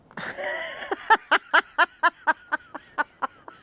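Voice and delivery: loud, high helpless laugh; burst of laughter. Real, not acted. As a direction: crack up at the fall. Friend fell over.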